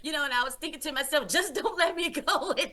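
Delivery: high, fast, breathless promise; frantic promising. Retold or imitated. Man rushing to promise Jesus everything, high and fast. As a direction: rush the promise out, laughing nervously.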